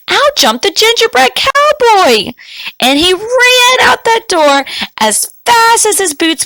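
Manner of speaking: high, lively burst of action; excited. A narrator's reading. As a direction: tell it with rising thrill. Cookie escapes excitedly.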